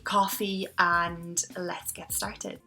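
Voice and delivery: normal talking voice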